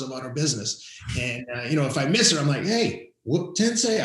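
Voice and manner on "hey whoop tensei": high, loud call out to someone; retold yell. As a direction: yell for your kid in another room. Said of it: "I yell out there to her".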